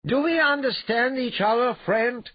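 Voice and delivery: loud, very high-pitched question; calling up. Acted. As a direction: press the point to someone high overhead. Calling up to suspended brute.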